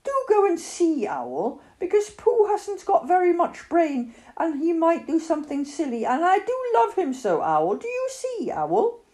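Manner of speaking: put-on high, pleading child character; earnest boy begging a friend for help; Christopher Robin. A narrator's reading.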